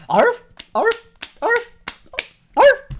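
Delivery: high, loud yapping arf like a seal; seal impression. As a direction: bark out arf like a silly seal. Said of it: "do my impression of a seal".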